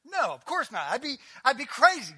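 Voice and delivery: ordinary talk, nothing marked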